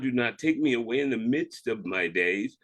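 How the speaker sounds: usual speaking voice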